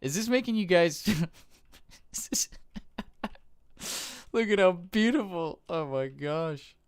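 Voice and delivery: high, loud, breaking into laughter; overwhelmed, tearing up. Real, not acted. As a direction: gush through teary laughter at the beauty. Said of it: "I'm tearing up".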